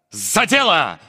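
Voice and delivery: normal talking voice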